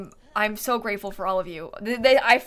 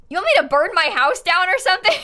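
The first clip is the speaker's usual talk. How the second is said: loud, higher, disbelieving; incredulous outburst; 7 semitones higher, 7 dB louder than usual. Real, not acted. Streamer balking at a viewer's wild dare, loud and incredulous. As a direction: push back in loud disbelief. Viewer suggests deep frying cutout.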